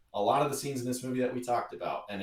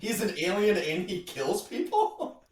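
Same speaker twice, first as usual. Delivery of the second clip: high and slow, aghast in jest; mock incredulous apostle; 9 semitones higher than usual. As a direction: stretch out the shocked question. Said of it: "They're like".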